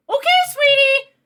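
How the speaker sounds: high, loud sing-song sweetness; exaggerated imitation of an overly agreeable dad; mock meek dad. Dad answering his yelling daughter, mocking high-pitched sweetness. Mimics the dad's meek reply.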